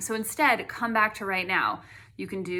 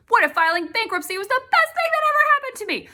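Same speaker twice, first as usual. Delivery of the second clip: bright, much higher, fast and hopeful; positive what if; 8 dB louder, faster than usual. Shown as a demonstration. Demonstrating a hopeful what-if to viewers, excited. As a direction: lift it high like good news. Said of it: "Give yourself a positive what if".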